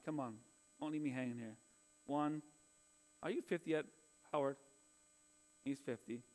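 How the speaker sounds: normal talking voice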